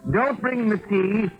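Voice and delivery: high, slowed call changing the order; calling the waiter. Acted. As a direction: call out across the tavern, drawing it out.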